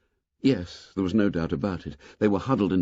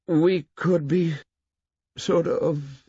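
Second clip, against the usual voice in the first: groping Simon; 7 semitones higher, slower than usual. A narrator's reading.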